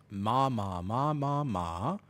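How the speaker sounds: "ma" five times, each on its own tone; Thai tones demo. Shown as a demonstration. Streamer demonstrates Thai tones to viewers, "ma" toned five ways. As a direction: give each "ma" a different Thai tone, last one rising. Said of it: "Thai is a tonal language".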